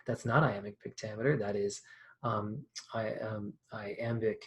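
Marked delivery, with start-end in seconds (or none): none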